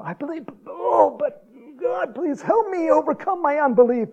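high-pitched imploring cry to God; acted pleading prayer. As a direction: plead it theatrically, voice pushed way up. Acting out a desperate prayer to God.

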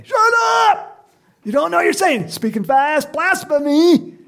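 high, loud acted outrage; angry scolding. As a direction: shout down the noisy children. Acts out telling children to shut up.

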